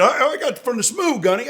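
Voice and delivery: put-on high, eager kid voice; lightly voiced young Marine; playful imitation of a proud young Marine. Marine's reply retold.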